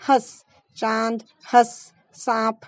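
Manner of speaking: हंस said slowly with a nasalised vowel, no n sound; drawn-out हंस, nasal through nose and mouth; slow careful हंस with nasal vowel; nasal vowel demo. Shown as a demonstration. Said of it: "हंस नहीं है वो".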